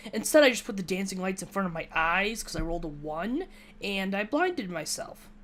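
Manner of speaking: ordinary talk, nothing marked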